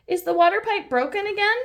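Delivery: put-on high, fast, eager little girl question; Peppa asking. Peppa eagerly asking Mister Bull about the pipe, high and fast. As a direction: rush it out, curious. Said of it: "asks Peppa".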